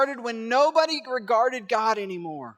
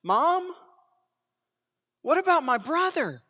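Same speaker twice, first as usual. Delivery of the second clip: pleading son; 7 semitones higher, 3 dB louder than usual. Pretend. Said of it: "this'll crush him".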